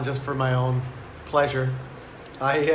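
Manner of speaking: normal talking voice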